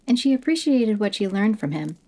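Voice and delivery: usual speaking voice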